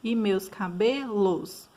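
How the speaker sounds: "e meus cabelos" with BE in "cabelos" stretched and stressed; stressed syllable drawn out. Shown as a demonstration. Teacher showing where the syllable count ends, stressed syllable drawn out. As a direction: lean long on the "be" of "cabelos", then "los". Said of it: "da sílaba mais forte é onde vai encerrar".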